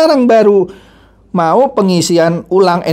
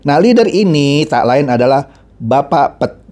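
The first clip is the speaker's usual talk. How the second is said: ordinary talk, nothing marked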